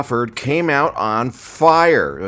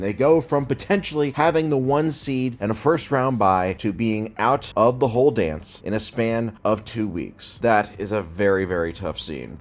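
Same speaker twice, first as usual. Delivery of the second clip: ordinary delivery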